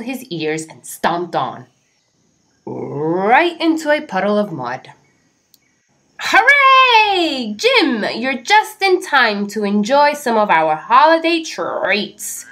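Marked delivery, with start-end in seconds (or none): 6.2-12.4 high loud cheer, a touch of croak; croaking frogs. A narrator's reading. Frogs welcome Jim to holiday treats. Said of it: "croaked the frogs".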